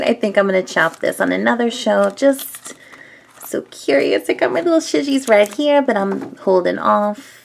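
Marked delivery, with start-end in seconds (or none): none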